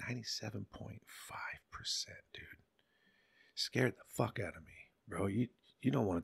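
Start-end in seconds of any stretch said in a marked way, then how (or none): 0.1-2.4 hushed. Real, not acted. Reveals dangerous drug purity.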